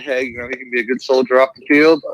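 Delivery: ordinary talk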